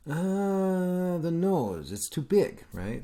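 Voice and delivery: put-on high, hammy Italian accent; a teacher's bad Italian accent; poor Italian accent. Teacher mocking Michelangelo's critic in an Italian accent. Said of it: "my poor Italian accent".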